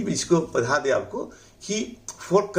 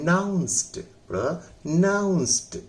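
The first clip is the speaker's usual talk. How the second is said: "नाउंस्ड" then "pronounced", ना stretched and higher; stress on NAA; 4 semitones higher, slower than usual. Shown as a demonstration. Teacher drills where stress falls, "pronounced" stressed on ना. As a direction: slowly say "pronounced" with ना lifted and drawn out. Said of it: "तो ना पर जोर लगाइए".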